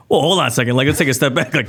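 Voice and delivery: halting a doctor in mock alarm; retold alarm. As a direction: act out putting the brakes on in surprise. Retold alarm at surgery news.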